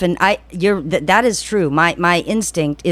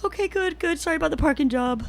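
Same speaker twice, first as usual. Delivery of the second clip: mock teary; 11 semitones higher, 6 dB quieter than usual. Pretend tearful parker.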